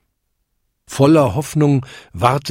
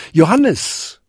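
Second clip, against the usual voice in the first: calling a name down the stairs, higher and a bit louder; 7 semitones higher, 4 dB louder than usual. Acted. Father Jakob calls his son from heaven, a mild call. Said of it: "rief er".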